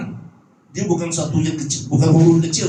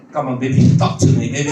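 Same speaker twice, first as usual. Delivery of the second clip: mock coaxing; 6 semitones lower, 5 dB louder than usual. Mock police coaxing an addict.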